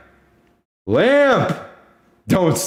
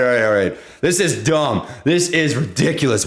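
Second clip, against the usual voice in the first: ordinary talk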